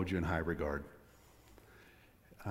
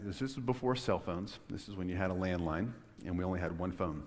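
ordinary delivery

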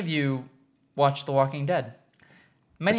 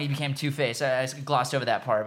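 ordinary talk

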